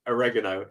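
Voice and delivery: inner-voice "Oregano" with stress on the second syllable; wrong pronunciation. Shown as a demonstration. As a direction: say "Oregano" as uh-REG-uh-no, low. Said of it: "I say in my head".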